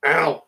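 mild mock pain yelp. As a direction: yelp as if bitten. Bitten by the creature.

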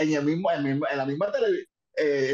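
ordinary delivery

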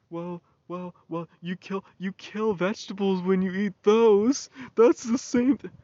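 high, loud mocking voice of meat-eaters; mocking people. Mimicking people who say vegetables die too, mock high voice. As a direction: sneer it back as the know-it-all. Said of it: "People are like".

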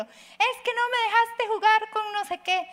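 put-on high, slow complaining kid voice; whiny child. Mother voices her upset child complaining, high and whiny. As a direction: play a sulking child protesting. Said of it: "por ejemplo".

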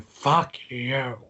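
hint of an imitated wrestling boss yell; mock-furious impression, words stretched out; Vince. Retelling the boss cursing British Bulldog, mock outburst. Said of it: "Vince vo-, voice told, told".

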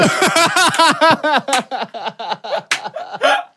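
high, rolling laugh; laughing hard; high laughter. Real, not acted. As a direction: crack up at the punchline. Laughing at own story.

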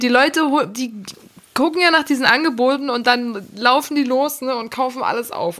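ordinary talk, nothing marked

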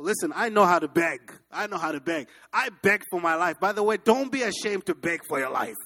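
ordinary delivery

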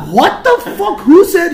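high, loud indignant yell; outraged disbelief. Retold or imitated. Retelling his outraged reaction.

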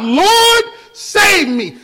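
high, loud cry for help; desperate cry. Retold or imitated. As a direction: cry out like a sinking man. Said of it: "and then he cried out".

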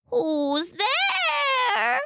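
very high, exaggerated old-lady falsetto; fake grandmother voice. A narrator's reading. Wolf in bed calling out, best grandmother voice. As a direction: stretch it out in a hammed-up granny pitch. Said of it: "using his best grandmother voice".

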